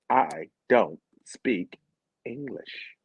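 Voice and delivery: slow, spaced-out words; deliberate retold refusal. Local woman lecturing a tourist, slow and pointed. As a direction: spell it out word by word.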